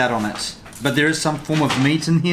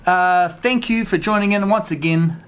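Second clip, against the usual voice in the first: usual speaking voice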